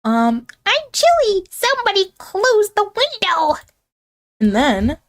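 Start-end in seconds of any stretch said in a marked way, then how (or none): 0.7-3.5 much higher squeaky complaint; put-on high voice, calling out; high-pitched character. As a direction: cry out in a tiny high voice.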